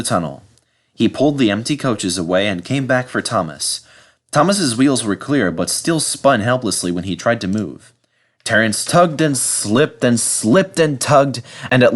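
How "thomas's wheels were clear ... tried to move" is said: ordinary delivery, nothing marked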